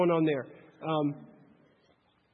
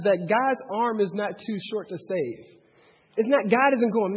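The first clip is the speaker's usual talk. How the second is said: usual speaking voice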